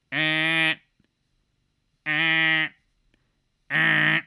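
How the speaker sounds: loud nasal-free buzzer "eh" repeated; loud mocking buzzer sounds. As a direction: buzz them like a wrong answer.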